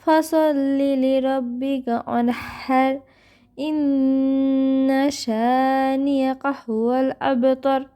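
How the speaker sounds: slow, drawn-out melodic recitation; tajweed recitation; Quran recitation. Shown as a demonstration. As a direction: chant each word long and even. Full recitation.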